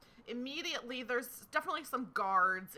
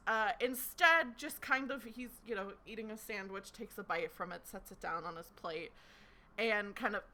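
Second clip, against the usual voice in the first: ordinary delivery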